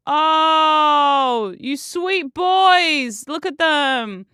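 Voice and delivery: loud, high, long drawn-out coo; real melting affection; cooing adoration. Real, not acted. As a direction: gush over beloved pets. Gushing over photo of cats.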